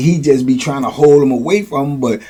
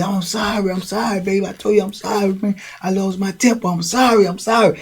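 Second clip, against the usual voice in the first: softer, higher pleading apology; apologizing; 6 semitones higher, 6 dB quieter than usual. Retold or imitated. Charles apologizing to Boo Boo, soft high pleading. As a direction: make up quietly, pitched up. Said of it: "they going all through it".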